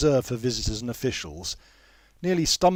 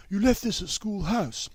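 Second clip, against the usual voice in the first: bright announcement; 9 semitones higher than usual. A narrator's reading. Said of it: "he announces brightly".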